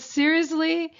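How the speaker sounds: incredulous. Retold or imitated. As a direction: act stunned, half-laughing disbelief. Acted quote of surprised fiancé.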